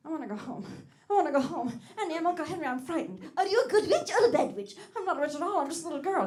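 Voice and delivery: high, loud Dorothy, pleading then frightened; Dorothy impression. Comedian showing the slot machine how Dorothy should sound. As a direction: play the frightened little girl from Oz. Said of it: "sound like the Wizard of Oz".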